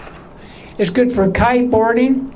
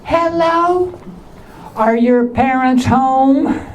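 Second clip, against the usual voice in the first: high voice; 4 semitones higher, slower than usual. Retold or imitated. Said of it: "Or I'll say".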